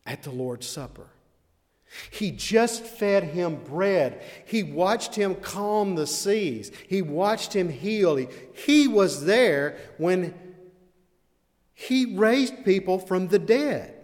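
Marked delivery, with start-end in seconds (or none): 2.1-13.9 higher and louder, slow, building fervour; full preaching. Acted. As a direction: preach it, rising with each line.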